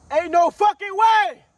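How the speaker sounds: slow, high, loud disbelief. Real, not acted. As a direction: stretch it out in a pained howl. Cries out in disbelief.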